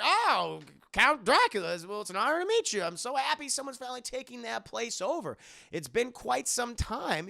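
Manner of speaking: high, loud, gushing welcome; eager doctor. Retold or imitated. Doctor Seward greets Dracula, high and gushing. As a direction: gush like a flattered old doctor.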